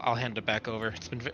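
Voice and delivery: usual speaking voice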